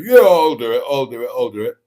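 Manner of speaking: hammed-up macho bravado, loud start; put-on macho boasting; mock manly macho. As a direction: blurt it out like a pretend tough guy. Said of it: "get all manly and macho".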